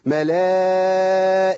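ملائكة ended with a هاء, not a تاء; stop on ha. Shown as a demonstration. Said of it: "أقول". (الوقف بالهاء في ملائكة)

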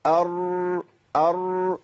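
أر held with a small contained flutter; slight vibration. Shown as a demonstration. Teacher demonstrates correct raa, gentle flutter in أر. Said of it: "في هزة كده".

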